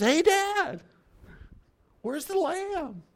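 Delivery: put-on a trusting boy asking his dad; innocent young son. Son on the mountain asking his father, high and innocent. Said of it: "turns around and goes".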